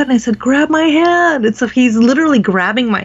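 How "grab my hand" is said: "Grab my hand" pitched much higher, a bit louder and slow; insist, calling out more urgently; urgent repeat. Retold or imitated. Repeats plea after husband asks what.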